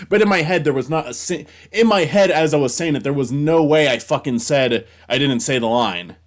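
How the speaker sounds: loud, high, exasperated rant; frustrated rant. Real, not acted. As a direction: vent the irrational anger, full volume. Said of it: "That always, that always infuriates me".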